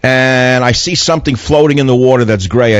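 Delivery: normal talking voice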